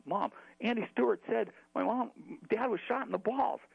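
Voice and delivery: put-on high-pitched, urgent kid call. Boy calls to his mom at the bathroom, high and urgent.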